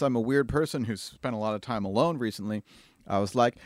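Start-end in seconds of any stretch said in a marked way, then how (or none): none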